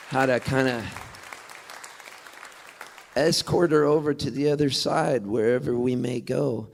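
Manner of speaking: normal talking voice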